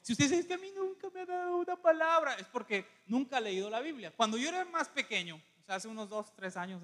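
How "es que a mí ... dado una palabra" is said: high whiny mock quote, higher than usual; mock plaintive whine; whining complaint. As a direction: imitate a complaining churchgoer in a raised whine. Mimics a complaining listener.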